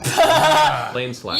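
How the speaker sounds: high, loud laughing line. Real, not acted. Friends laughing at the empty rug, laughing. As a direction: say it through the laughter. Table laughing at bad reveal.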